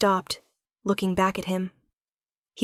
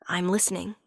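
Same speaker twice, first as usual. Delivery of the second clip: soft, hushed, smiling reply; 4 dB quieter than usual. Acted. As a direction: let the smile come through quietly. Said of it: "She heard the smile in his voice".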